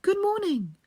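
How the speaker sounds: high sing-song call. A narrator's reading. Girl calling to grandmother, high bright greeting. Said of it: "She called out".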